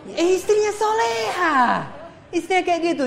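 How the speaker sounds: a husband gushing over his pious wife, high and excited; delighted husband. Retold or imitated. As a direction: gush over a perfect wife.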